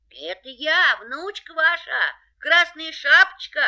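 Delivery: wolf in a high, thin voice; wolf faking a little girl's voice; wolf in disguise. A narrator's reading. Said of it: "ответил волк тоненьким голоском".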